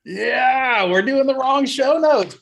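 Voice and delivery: high, drawn-out crowing; mock exasperation. Real, not acted. Host teases cohost over wrong show notes, high and drawn out. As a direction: stretch it out high and loud.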